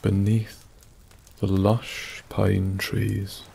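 usual speaking voice

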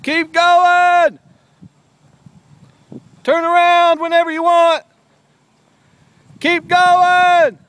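high, loud encouraging cheer; hoarse marathon cheering. Real, not acted. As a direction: cheer them on from afar. Cheering kid on bike.

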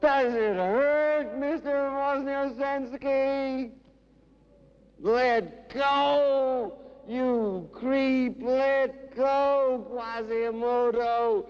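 high, impassioned cries, easing down at the end; theatrical high-pitched recital; anguished poetry declamation. Acted. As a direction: cry it out high, then slow and sink. Dramatic quoted outburst within poem.

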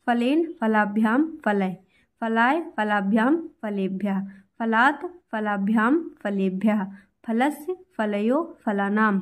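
slow, even chant of the forms line by line; right way to recite. Shown as a demonstration. Teacher showing students the right way, chanting slowly. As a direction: recite each row slowly in a steady rhythm. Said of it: "ऐसे इसको पढ़ना है".

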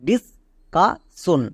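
Discussion stretched out syllable by syllable; slow clear model word; slow demo. Shown as a demonstration. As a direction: spell out discussion very slowly for the class. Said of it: "পুরোটা কি দাঁড়ালো".

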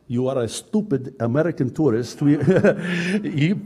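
ordinary talk, nothing marked

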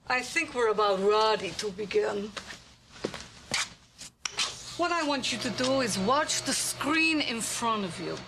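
ordinary talk

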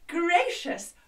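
lightly posh exclamation. Shown as a demonstration. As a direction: put on a posh voice. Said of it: "Can you do it?".

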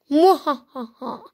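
slow hammy evil laugh; evil villain cackle. Acted. Gloating scammer evil laugh.

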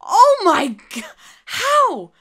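high, disbelieving "how"; can't believe what you saw; astonished. Real, not acted. Amazed reaction to trick.

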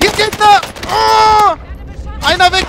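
high, loud excited yelling; excited shout. Real, not acted. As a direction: shout the callout in the heat of the fight. Gamer shouting callouts in firefight.

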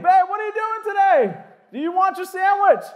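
exasperated wife, pitched high and loud; a nagging wife's shrill-sounding call, hammed up; high mock wife. Wife asking Noah about his day, high mock call. Wife asks, year fifteen.

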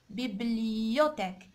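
"بيبليوتيك" slow and low, drawn out on "li-yo"; pronunciation demo. Shown as a demonstration. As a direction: stretch "بيبليوتيك" to show the i-yo glide. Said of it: "ننطقوها إيو".

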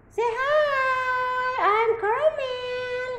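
high slow kitten voice. Pretend. Voicing kitten as itself.

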